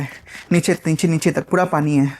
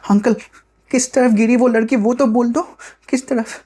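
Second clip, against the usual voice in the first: put-on higher-pitched child's urgent question; pleading boy; 6 semitones higher than usual. Ronny begs the paan-shop uncle for directions, high pleading boy.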